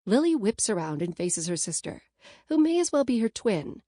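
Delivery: usual speaking voice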